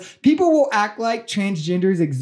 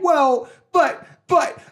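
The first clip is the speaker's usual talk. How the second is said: higher-pitched sputtering objection; mock protest; 8 semitones higher than usual. Host mimics MAGA supporters turning around to complain, mock protest. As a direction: imitate an indignant complainer, pitched up.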